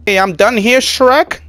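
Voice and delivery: high, loud call across the room. Pretend. Worker telling the boss the job is over, calling out. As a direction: holler it to the boss, rising.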